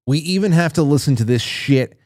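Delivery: usual speaking voice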